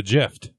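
"gift" said with a J sound, like "jift"; soft G. Shown as a demonstration. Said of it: "pronounce it gift".

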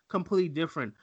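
usual speaking voice

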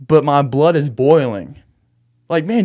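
ordinary delivery

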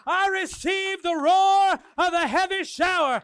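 loud, high declaration; shouted declaration. Real, not acted. Congregation standing, shouting the declaration. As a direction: declare it at full voice. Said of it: "begin to declare".

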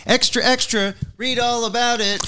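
high newsboy cry; rapid old-time reporter. Acted. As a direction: hawk the headline like a paperboy.

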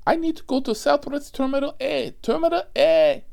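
high, loud shriek of a frantic passenger; mimicked passenger yelling. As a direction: scream it like the panicked rider. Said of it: "and scream and beat the seat".

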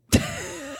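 high, wheezy fit of laughter; helpless quiet laughing; real laugh. Real, not acted. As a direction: crack up at your own story. Laughs at own story.

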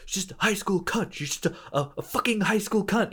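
high, bitter mutter, lightly voiced. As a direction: spit it out under your breath, bitter. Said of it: "muttering to himself".

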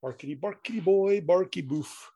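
playful dog voice, barky. Pretend. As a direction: bark the words like a silly dog. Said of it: "I'm a floppy-eared dog".

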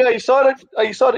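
acting out an over-excited parent; excited pestering. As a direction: pester the kid with giddy excitement. Excited parent pestering calm child.

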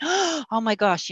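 sharp gasp, then a high, drawn-out exclamation; horrified gasp. Retold or imitated. Woman recalling challenging God, horrified gasp. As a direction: re-enact a shocked reaction to yourself. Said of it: "I had this terrible emotional reaction".

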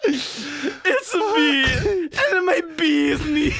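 high voice breaking into laughter; can barely speak through tears of laughter; laughing crying. Real, not acted. Cohost collapsing with laughter mid-bit, high and strained.

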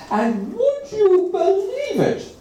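the narrator marvels to the listener; amazed aside. A narrator's reading. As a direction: draw the listener into the wonder. Storyteller amazed.

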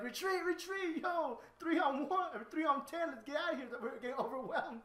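a hammed-up frightened retreat call; retreating thugs. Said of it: "So like".

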